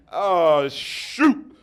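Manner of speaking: usual speaking voice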